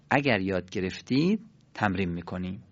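ordinary talk, nothing marked

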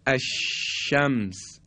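الشمس very slow, the doubled shin stretched with air flowing. Shown as a demonstration. Teacher showing students the continuous shin, drawn out slowly.

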